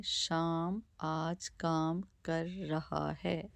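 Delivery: very slow, word by word reading; slow example sentence. Shown as a demonstration. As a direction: spell the sentence out slowly for pupils. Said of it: "और एक वाक्य हम देखते हैं".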